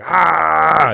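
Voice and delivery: loud, high, held scream; imitated scream. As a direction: scream like the man who let go. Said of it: "He starts screaming at him".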